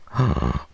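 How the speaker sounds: audible excited gasp. Real, not acted. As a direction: gasp in delight at food. Seeing a dish that looks good.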